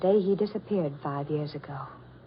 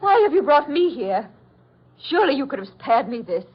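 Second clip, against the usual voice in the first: pleading outburst; 6 semitones higher, 6 dB louder than usual. Acted.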